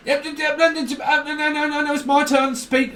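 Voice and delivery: high, eager mock interrupter jumping in; squeaky impatient know-it-all cutting in; pushy interrupter. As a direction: mimic someone barging into the talk. Mocking an interrupting talker.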